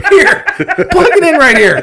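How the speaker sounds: high, loud mocking impression; mock a slapdash worker brushing it off; careless installer. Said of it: "somebody was just like".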